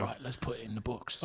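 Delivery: soft, breathy invite; hushed Statham voice. Retold or imitated. Jason Statham asking a comedian to hang out. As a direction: murmur it, shy and eager.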